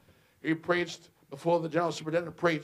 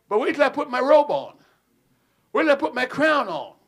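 louder, higher proud boast; 9 semitones higher, 10 dB louder than usual. Retold or imitated. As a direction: swell up like a king dressing. Boasting of robe and crown.

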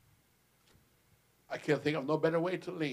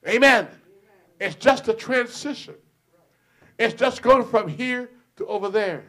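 raised, loud preaching; 5 semitones higher, 10 dB louder than usual. Acted. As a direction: drive it home to the church. Preaching section.

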